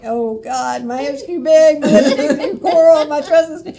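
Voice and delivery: high, loud, laughing self-conscious panic. Retold or imitated. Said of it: "I was like".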